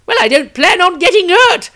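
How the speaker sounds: high, loud, quick wry reply; wizard's retort. Acted. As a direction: brush off the priestess with a dry quip. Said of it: "came the wizard's reply".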